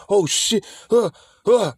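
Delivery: high, gasping jolt awake from a nightmare; startled waking panic. Retold or imitated. Veteran waking among dead bodies, startled high gasps. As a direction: gasp it out like a soldier snapping awake.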